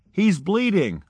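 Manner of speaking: put-on young doctor shouting alarm over the patient; retold shout. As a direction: call out the emergency. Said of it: "I shouted".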